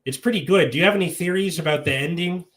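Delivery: put-on chatty small talk in a mild Midwest accent. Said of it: "Midwest accent for that".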